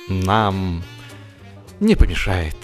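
ordinary delivery, nothing marked

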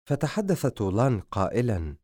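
usual speaking voice

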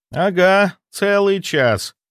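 usual speaking voice

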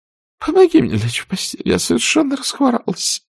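high, moaning invalid aunt. A narrator's reading. Aunt Pittypat moans to be helped to bed. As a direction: groan out a plea to be put to bed. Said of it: "со стоном произнесла".

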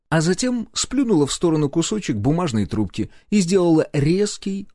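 ordinary delivery, nothing marked

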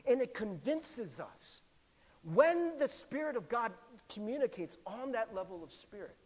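ordinary talk

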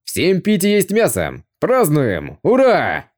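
boisterous prince calling a feast; loud festive shout. A narrator's reading. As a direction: rouse the men to celebrate. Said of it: "громко крикнул".

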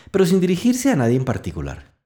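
ordinary delivery

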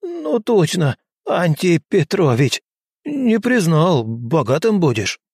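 put-on old servant eager to please; flustered old man. A narrator's reading.

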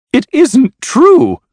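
high, loud, drawn-out protest; crying out. A narrator's reading. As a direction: cry out in desperate denial. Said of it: "cried Lady Blemley".

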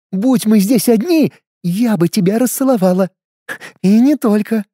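purring femme fatale. A narrator's reading. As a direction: purr it, sultry and teasing. Kasumi voiced by narrator. (мурлыкающая роковая женщина)

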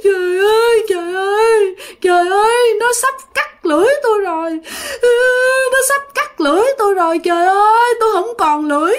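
high-pitched wailing lament. Acted. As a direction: wail it out while crying, dragging out each "trời ơi". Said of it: "Mà vừa khóc mà vừa nói như vậy". (vừa khóc vừa than, giọng cao kéo dài)

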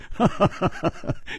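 breathy run of ha-ha-ha; big laugh. A narrator's reading. Friend amused by Hu's surrender, hearty laugh. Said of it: "楚留香大笑".

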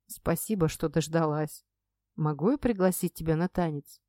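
low, soft and sincere; own voice. Acted. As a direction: drop the act, speak softly to her. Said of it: "произнёс теперь своим голосом".

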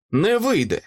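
high-pitched defiant cry; understated shout of refusal; shouted defiance. A narrator's reading. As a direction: call it out high, a mild shout. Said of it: "крикнув я".

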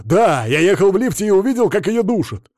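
loud, high, heated outburst; worked-up shouted testimony; agitated witness. A narrator's reading. Agitated witness insists on murder.

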